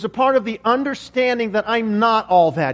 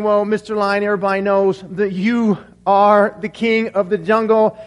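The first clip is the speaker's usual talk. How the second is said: ordinary delivery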